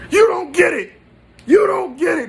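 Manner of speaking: very high, strained yell; anguished shout. Retold or imitated. Hurt son protesting to his mom, anguished high shout. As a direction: cry out in frustrated falsetto, loud.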